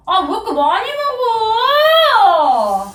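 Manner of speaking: wailing complaint, last word drawn out; tearful protest. Real, not acted. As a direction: whine in outrage, close to crying. Brother protests food being eaten. (울먹이며 길게 따지기)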